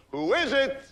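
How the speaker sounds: high, lightly voiced woman's call; woman at the door. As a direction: answer the knock as the girl. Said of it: "She goes".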